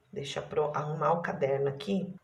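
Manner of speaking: ordinary delivery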